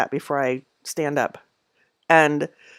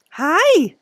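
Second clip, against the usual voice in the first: cat greeting; 11 semitones higher, 5 dB louder than usual. Real, not acted. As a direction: coo a high hello to a cat. Greeting the cats.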